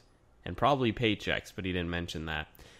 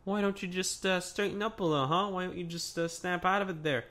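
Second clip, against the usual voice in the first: imitating Enzo; 7 semitones higher than usual. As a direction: lightly needle him like Enzo. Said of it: "Enzo was like".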